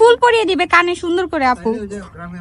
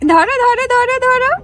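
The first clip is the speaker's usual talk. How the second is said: excited urging; 8 semitones higher than usual. Real, not acted. Urging toddler to catch.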